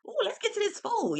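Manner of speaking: squeaky high mock-Portia gush; high, soft, eager food call; imitating Portia. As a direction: chirp it like a greedy guest at the grill. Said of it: "Portia tell me something".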